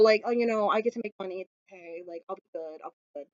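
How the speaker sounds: soft, trailing away to a murmur; putting on a brave face. Retold or imitated. Warlock masking his hurt to a friend, trailing-off murmur. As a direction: fade out as if brushing it off.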